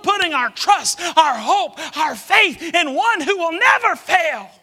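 loud, soaring preacher cry; preaching at full force. Acted. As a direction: lift it up like a revival climax.

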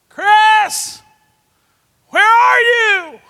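long, high, loud shouted call across the woods. Retold or imitated. Searcher calling through the woods for the lost boy, long loud shout. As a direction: call his name out loud to find him.

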